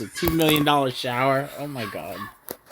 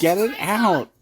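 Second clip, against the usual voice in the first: excited shout; 7 semitones higher, 3 dB louder, slower than usual. Real, not acted. Dad reacting to helicopter.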